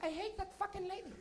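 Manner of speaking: put-on high, soft accented grumble; spiteful mom mutter. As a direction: badmouth the friend after she leaves. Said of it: "she's like".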